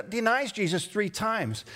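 usual speaking voice

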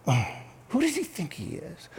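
soft, scornful muttering; hushed mock indignation; grumbling under breath. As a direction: mimic a grumbler muttering to a neighbor. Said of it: "they're grumbling underneath their breath".

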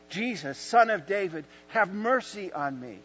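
retold cry. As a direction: call out for mercy, not yet at full volume. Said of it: "And he cries out".

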